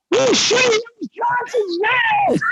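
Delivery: high, slow shouted line; high slow soldier call. Acted. Roman soldier calling out.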